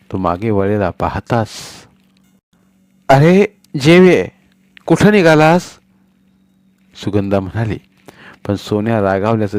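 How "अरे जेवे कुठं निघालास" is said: calling out. Acted. Mother calls leaving son.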